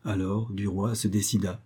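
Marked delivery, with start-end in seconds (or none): none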